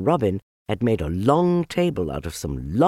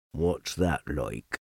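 low, quiet, glum donkey; gloomy donkey; 7 semitones lower, 6 dB quieter, slower than usual. Acted. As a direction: mope it out low and soft.

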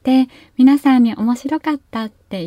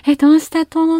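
high, alarmed what's wrong; a little girl rushing in, worried; startled child's question; 7 semitones higher, 4 dB louder than usual. Retold or imitated. Child asking mother what happened, alarmed.

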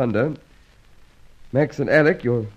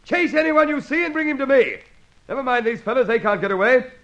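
raised, urgent orders to his men; commanding shout. Acted. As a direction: bark the orders across the hall. Inspector orders men to chase.

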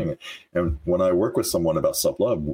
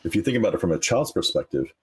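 ordinary delivery

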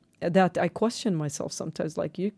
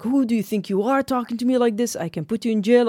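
higher, fast mock officer voice; officer threatening; 5 semitones higher, 3 dB louder, faster than usual. Officer threatening her with jail, mock stern and fast. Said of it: "He's like".

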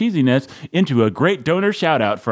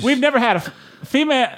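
much higher comic retake; 10 semitones higher, faster than usual. Shown as a demonstration. Host retrying the intro for a female helper, pitched way up. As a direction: squeak the word female up high. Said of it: "let me start that again".

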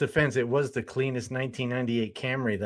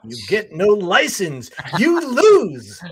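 gloating examiner voice, much higher and louder; mock examiner; 6 semitones higher, 10 dB louder, much slower than usual. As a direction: taunt him like a smug driving examiner: you lose. Mocking the driving examiner.

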